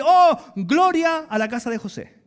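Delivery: mock praise with a high, loud "Oh"; exclamación de alabanza. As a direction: exclaim in exaggerated admiration. Imagined people praising him. (exclamación de admiración fingida)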